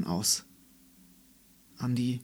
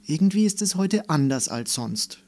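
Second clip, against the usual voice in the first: observing; 5 semitones higher, 5 dB louder than usual. A narrator's reading. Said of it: "stellte sie fest".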